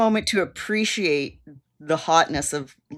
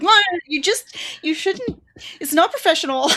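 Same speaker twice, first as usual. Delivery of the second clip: higher-pitched, halting mock protest; flustered objection; 10 semitones higher than usual. Podcaster voicing Chloe objecting to Lucifer, flustered. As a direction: stumble into a half-amused imitation of indignation.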